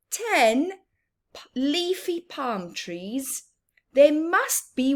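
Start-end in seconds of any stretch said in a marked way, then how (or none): none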